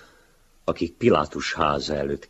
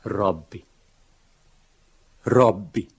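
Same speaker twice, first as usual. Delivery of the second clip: rising, louder plea, lightly tearful; mild sobbing cry; 5 semitones higher, 5 dB louder than usual. A narrator's reading. Wretched man to his rabbi, mildly sobbing plea. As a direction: call out to the master with a touch of a sob.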